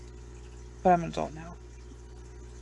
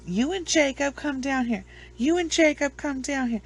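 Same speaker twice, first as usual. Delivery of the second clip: higher voice, calling them down; mock angry summons; 7 semitones higher than usual. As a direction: imitate a mom demanding they come out. Imitating mother summoning father and son.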